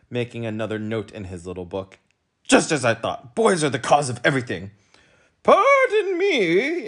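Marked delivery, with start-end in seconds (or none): 2.4-4.7 fast, loud certainty; smug policeman. A narrator's reading. Policeman accuses boys. 5.4-6.9 very high, slow, loud plea; insisting humbug. A narrator's reading. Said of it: "insisted the humbug".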